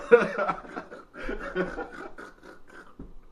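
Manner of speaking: high breathy cackle; laughing at his own shoe joke; wheezing laugh. Real, not acted.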